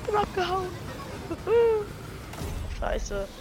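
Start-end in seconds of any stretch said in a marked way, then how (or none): 0.7-2.7 breathy high laugh; high giggle. Real, not acted. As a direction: laugh with relief. After escaping.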